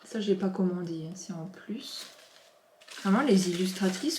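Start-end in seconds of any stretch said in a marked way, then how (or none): 1.1-2.0 soft secretive whisper; whispered aside. Real, not acted. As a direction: let the viewers in on a little secret. Whispering aside about surprise gift.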